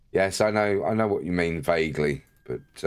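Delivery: ordinary talk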